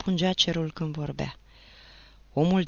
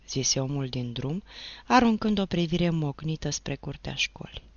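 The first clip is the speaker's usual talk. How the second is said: ordinary delivery, nothing marked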